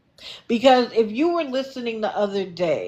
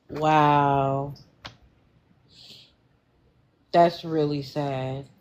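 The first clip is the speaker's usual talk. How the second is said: low, subdued and saddened; somber sympathy; 7 semitones lower, slower than usual. Real, not acted. Host hearing of a wife's death from cancer, somber sympathy. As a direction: let the news sink in, low.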